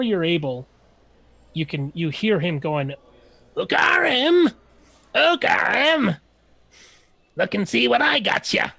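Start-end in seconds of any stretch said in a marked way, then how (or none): 3.6-8.7 high, loud, eager shout of a scrappy halfling; excited calling out. Acted. Halfling Bavik calls to his boss, high loud and eager. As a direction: call out gleefully, much higher and louder.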